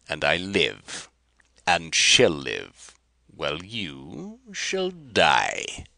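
slow threat. Acted. Rapaju threatening Kar.

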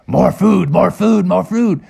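greedy cartoon cub voice; begging bears. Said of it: "they were like".

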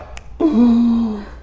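high startled intake of breath; high mock gasp. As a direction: gasp in hammed-up horror. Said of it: "his mind's like".

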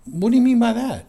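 high startled question; taken aback. Retold or imitated. A friend's warning about hell, answered with a startled high question. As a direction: ask it in disbelief. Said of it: "And I said".

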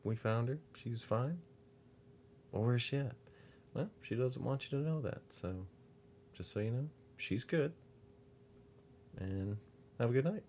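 high, sweet, overly polite voice; officer politely telling a man his wife is fine; mock polite officer. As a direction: brush him off nicely.

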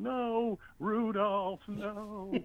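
put-on high wailing child voice; crying little boy. Little boy crying over the deer his grandpa hit. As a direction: wail it like a heartbroken kid. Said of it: "he's going".

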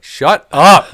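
sudden loud high shout; yelled out. Retold or imitated. Yelling at the political rambler, a loud shout. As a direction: bark it across the room to shut him up. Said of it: "I, like, just yelled that out".

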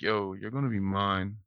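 slow, low come-on in a softened voice; low, predatory. Acted. Host plays a baddie pulling up on you, low and predatory. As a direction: drop it low and slow, like a creep claiming someone. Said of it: "your voice was very low and predatory".

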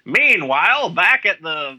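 high, loud serial narrator; 1940s radio voice. Acted. Podcast host spoofing a Superman radio serial, 1940s announcer voice. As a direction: announce it like a vintage radio cliffhanger. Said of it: "in my nineteen forties radio voice".

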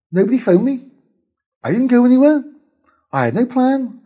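high, loud, pleased recounting; cheerful, upbeat. Pretend. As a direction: proudly brag about doing nothing. Voicing his happy lone day.

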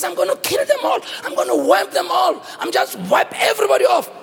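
high, emphatic threat; God's angry threat. Acted. God threatens the people before Moses, angry. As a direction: vow destruction with mock fury. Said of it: "when God is in a bad mood".

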